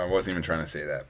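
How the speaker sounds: normal talking voice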